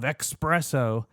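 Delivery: "espresso" said as ex-PRESS-oh; "espresso" starting with EX, as a wrong order; mocked mispronunciation. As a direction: put a K sound into "espresso". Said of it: "people pronounce them as if it's E X".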